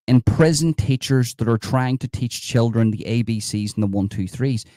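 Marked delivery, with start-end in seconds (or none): none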